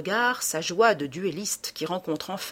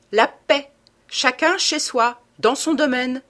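louder, higher, emphatic declaration; raised-voice insistence; firm demand; 6 semitones higher, 6 dB louder than usual. A narrator's reading. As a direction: lay down terms forcefully. Demanding peace in an argument.

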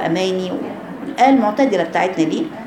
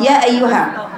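usual speaking voice

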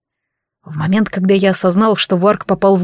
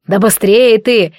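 slow, louder angry growl; 4 dB louder, slower than usual. Acted. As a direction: growl at the clumsy helper. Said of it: "зарычал Рафиль".

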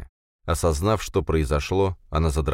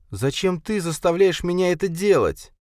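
high bitter pleading voice; bitter plea; 12 semitones higher than usual. A narrator's reading. Linda begging Khalid for a reason, bitter. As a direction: plead with hurt in every word. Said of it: "горько спросила она".

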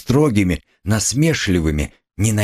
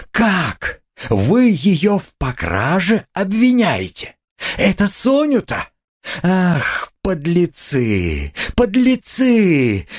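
high-pitched, drawn-out furious cries; frantic outrage; 11 semitones higher, slower than usual. A narrator's reading. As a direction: lash out as if suddenly unhinged. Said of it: "Катерина Ивановна и точно сорвалась". (срывающийся возмущённый крик)

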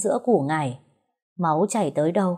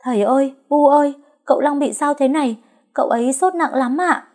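loud, high panicked call for help; calling loudly; 8 semitones higher, 5 dB louder than usual. A narrator's reading. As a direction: shout for the parents in alarm. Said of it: "chạy ra ngoài kêu to". (kêu to hoảng hốt)

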